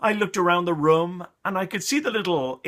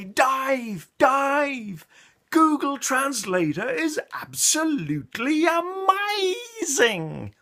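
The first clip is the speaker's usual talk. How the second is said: much higher, slowed, exaggerated excitable voice; tipsy colleague; 10 semitones higher, much slower than usual. Colleague with wine on the plane, hammed-up high gushing.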